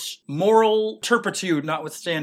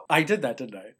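usual speaking voice

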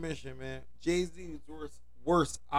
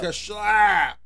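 mock slap sound; 4 semitones higher, 7 dB louder than usual. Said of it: "I gotta say it just like that".

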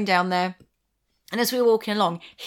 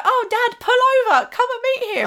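higher, eager call to dad; excited girl; 12 semitones higher than usual. Pretend. A girl to her dad, much higher and eager. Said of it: "a girl would be like".

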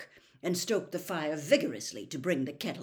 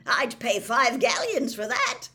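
loud, high, hearty guffawing delight; booming, laughing jubilation; roaring with laughter; 13 semitones higher, 11 dB louder than usual. A narrator's reading. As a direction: roar it out through laughter. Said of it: "Bagman roared with laughter".

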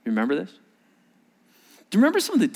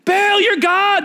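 much higher, loud yell; 5 dB louder, slower than usual. Acted. Prophets yelling at Baal, loud high cry. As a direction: yell it desperately. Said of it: "they're yelling".

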